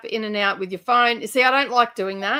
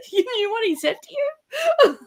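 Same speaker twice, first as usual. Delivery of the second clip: laughing disbelief; 13 semitones higher, faster than usual. Retold or imitated.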